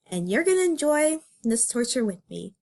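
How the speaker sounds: ordinary talk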